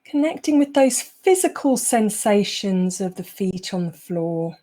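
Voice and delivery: unhurried meditation voice, long pauses between phrases; guided meditation. Acted. Teacher easing the group out of a busy day, guided meditation. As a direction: guide them calmly into the present moment.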